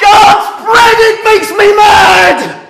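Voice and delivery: very high, loud rage; furious shout. Acted. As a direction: scream out the frustration. Father in full rage.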